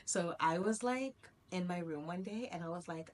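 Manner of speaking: ordinary talk, nothing marked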